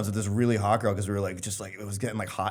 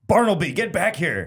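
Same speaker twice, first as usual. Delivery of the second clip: higher-pitched mock mom yell; 7 semitones higher than usual. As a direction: call sharply after a straying child. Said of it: "some mom yelled at her kid".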